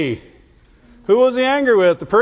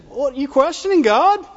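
a lightly voiced scolding retort, pitched up; mock indignant challenge; 5 semitones higher than usual. Preacher voicing a scandalized believer, mock indignant. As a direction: toss it back like a shocked church member. Preacher mocks someone questioning God.

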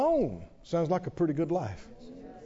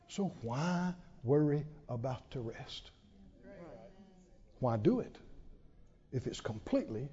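hushed appeal; 4 semitones lower, 7 dB quieter than usual. Acted. Preacher drops to hush.

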